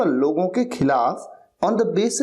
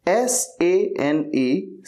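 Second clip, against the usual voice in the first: slow spelling; much slower than usual. Shown as a demonstration. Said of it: "spelling the word aloud".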